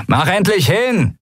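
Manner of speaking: high, irritated shout; annoyed call. A narrator's reading. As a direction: call out, fed up. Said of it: "rief der Mensch ... genervt".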